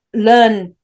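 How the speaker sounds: usual speaking voice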